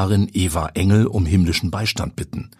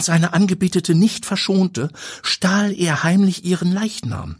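tour guide storyteller; 9 semitones higher than usual. A narrator's reading. Walburga tells ghost legend.

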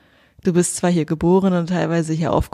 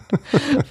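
short laugh; 9 semitones higher than usual. Real, not acted. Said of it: "laugh after joke".

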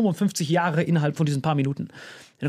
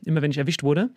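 ordinary talk, nothing marked

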